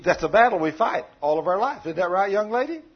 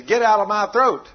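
loud, forceful command to the devil; acted rebuke; 3 semitones higher, 8 dB louder than usual. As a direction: act out casting the devil out, voice raised.

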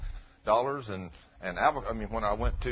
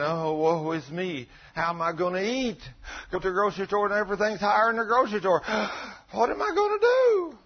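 high, loud wail of self-pity rising to a squeal; mock woe; 8 semitones higher, 4 dB louder than usual. Preacher mocking believers who worry over prices, wailing. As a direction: overplay the despair. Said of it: "You're thinking".

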